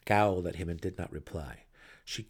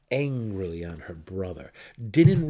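ordinary delivery

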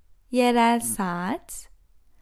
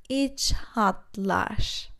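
slow; 3 semitones lower, much slower than usual. Shown as a demonstration. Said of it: "repeated slowly".

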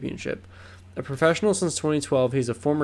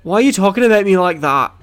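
louder, higher mock Liverpool tough guy; mock Scouse Paddy; 7 semitones higher, 10 dB louder than usual. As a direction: ham up a Scouse fighter squaring up. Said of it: "he talks like this, lad".

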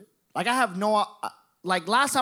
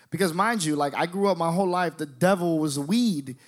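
ordinary talk

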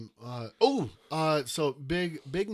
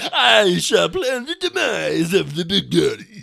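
loud, lightly hammed cartoon villain; a touch of a grand evil schemer; mustache twirling villain; 3 semitones higher, 12 dB louder than usual. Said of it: "mustache twirling".

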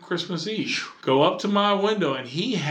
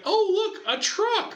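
put-on very high, laughing childlike delight; delighted kid brother; slower than usual. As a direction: squeal like a little brother finding a toy. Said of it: "Barry like".

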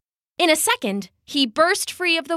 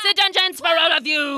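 higher, agitated put-on royal voice; threatening king; 6 semitones higher than usual. King threatens the whole chanting crowd, high frantic rant. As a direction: threaten everyone as a tyrant losing control.

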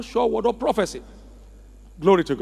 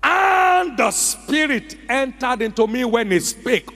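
preaching at full force; 4 semitones higher than usual. Acted. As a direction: lift the voice and proclaim.

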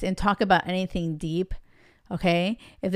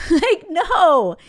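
loud, high, laughing-edged no; emphatic rejection; 8 semitones higher, 6 dB louder than usual. Real, not acted. Coach shooting down a dater's wishful excuse, loud and emphatic. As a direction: burst out in exasperated disbelief.

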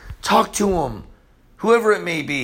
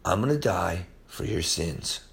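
low, soft voice of grave sincerity; solemn, hushed; 10 semitones lower, 7 dB quieter than usual. Acted. Preacher voicing Jesus before the cross, solemn and hushed. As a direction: drop low and let it land quietly.

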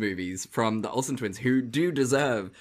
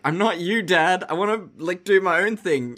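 higher and louder, a mock-earnest son standing up to his dad; son defying dad; 6 semitones higher, 5 dB louder than usual. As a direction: play a petulant young lord telling off his father. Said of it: "confronted his dad and was just like".

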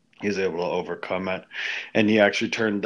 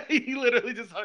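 laughing; 14 semitones higher, faster than usual. Real, not acted. Laughing while retelling the hang-up.

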